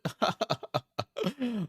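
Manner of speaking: high-pitched burst of giggles; cracking up at the fight; squealing laugh. Real, not acted. Laughing at the scuffle.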